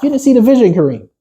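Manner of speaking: wife scolding. Retold or imitated. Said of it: "She was like".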